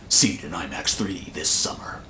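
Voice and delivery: deep, soft announcer sign-off; low trailer voiceover. Pretend. Trailer parody ends with release line.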